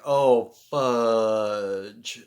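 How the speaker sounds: loud, drawn-out oh fudge; mock dismay. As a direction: blurt it out in dismay. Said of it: "I'm like".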